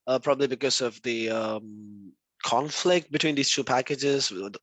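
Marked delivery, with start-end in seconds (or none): none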